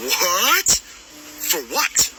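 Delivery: a sharp rising what; shocked disbelief. Retold or imitated. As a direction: react as if hearing it for the first time. Retelling call, shocked disbelief.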